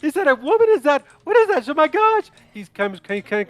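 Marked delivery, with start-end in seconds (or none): 0.0-2.3 shrill, far higher shocked squeal; horrified Smedley. Acted. Smedley seeing the burned woman, squealing in horror. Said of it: "Smedley's like".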